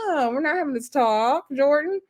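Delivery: ordinary delivery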